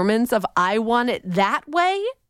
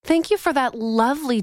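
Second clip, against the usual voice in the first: ordinary talk, nothing marked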